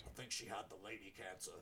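a hint of an old soldier, low and soft; lightly voiced, low, offhand mumble; mumbled old soldier. Widower mentioning his wife's death, lightly voiced, low and soft. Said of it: "he's like".

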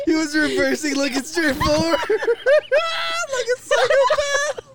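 high squealing through laughter; cracking up while telling it; laughing hard. Real, not acted. Cracking up while retelling the scene.

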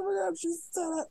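high, loud squeal of disbelief; excited exclamation. Retold or imitated. Squealing at the screen when the movie says its own title. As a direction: shriek it like you can't believe the movie said it. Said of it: "that's literally what I was like".

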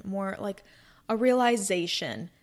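normal talking voice

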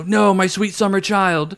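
high, slow, patronizing coo; mock pity. To a friend who stepped in a puddle, mock pity. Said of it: "I love saying it to people's face".

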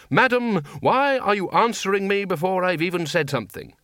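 high, exasperated demand. A narrator's reading. Exasperated at being answered early.

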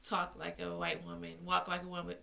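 ordinary talk, nothing marked

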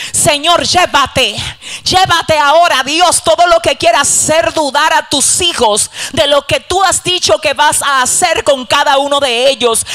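forceful, rising pulpit prayer; fervent prayer. Real, not acted. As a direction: plead with God at full voice. Leads a fervent prayer aloud.

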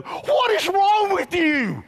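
high, loud, slow shout of disbelief; mock-outraged yell; incredulous shout. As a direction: throw the question right back at them. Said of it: "I wanna look back at them and go".

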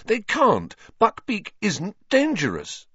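high, insistent denial; indignant protest. A narrator's reading. Harry defending Buckbeak to his friends, indignant.

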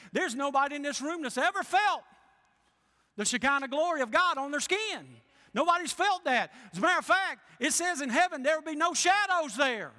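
loud, rising preacher's cry; full-force preaching. Acted. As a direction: preach it out to the whole room, slowing on the glory.

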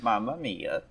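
put-on throwaway Italian-accent catchphrase; mock Italian. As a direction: play at an Italian accent, under the voice. Keeps up mock Italian bit.

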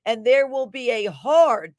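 "hard" punched loud at the top of the line; "hard" struck like a hammer blow; capital HARD. Acted. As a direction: lean hard on "hard". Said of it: "that's capitalized".

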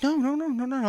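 high string of no's; light, high-pitched imitation; imitated quick reassurance. Dancer welcomes him to sit.

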